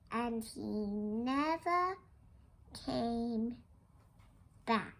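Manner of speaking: slow, soft, spaced-out words; quiet, drawn-out storybook ending; hushed finality. A narrator's reading. Hushed end to the farmer's chase.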